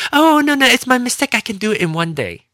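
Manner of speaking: high, fluttering mock apology; squeaky sorry-clerk voice; apologetic agent. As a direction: agent admitting her mistake.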